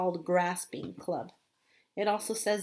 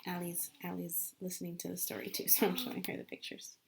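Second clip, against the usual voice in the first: soft quick aside, dropping out of the reading; quiet aside; 4 semitones lower, 10 dB quieter, faster than usual. Real, not acted. Explaining to the audience that she shows Allie pictures, in a soft quick aside. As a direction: murmur it off to the side.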